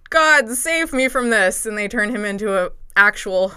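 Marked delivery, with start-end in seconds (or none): 0.1-1.7 higher, slow, pleading prayer; desperate plea to the gods. Retold or imitated. Retelling the disguised girl begging the gods, slow higher plea. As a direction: beg the gods to save you, slowly.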